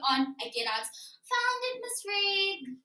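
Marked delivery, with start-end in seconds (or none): 1.3-2.8 high, sing-song fake cheer; sing-song innocence. Girl hides her theft from the teacher with sing-song cheer. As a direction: chirp it like nothing happened.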